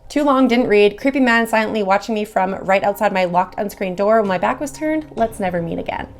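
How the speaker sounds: ordinary delivery, nothing marked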